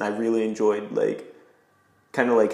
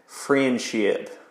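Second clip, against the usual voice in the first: friendship drawn out slowly in a thick Southern accent; 2 semitones higher than usual. Shown as a demonstration. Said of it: "You gotta say it with a really thick Southern accent".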